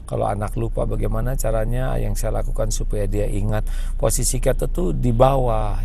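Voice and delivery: normal talking voice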